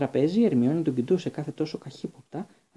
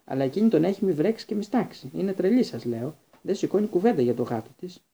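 angry rant; 6 semitones higher, 4 dB louder than usual. A narrator's reading. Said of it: "είπε θυμωμένος ο Ρον".

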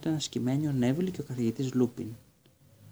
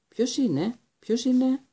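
high, rising, loud frightened question; alarmed voice in the dark; 11 semitones higher, 4 dB louder, slower than usual. A narrator's reading. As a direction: call out into the dark, scared. Startled cry in the dark. (φοβισμένη, δυνατή ερώτηση στο σκοτάδι)

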